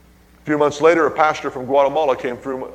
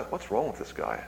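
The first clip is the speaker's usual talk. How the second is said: soft uneasy question under the breath; hushed wondering; 11 dB quieter than usual. Retold or imitated. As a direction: ask it almost to yourself. Retold inner thought.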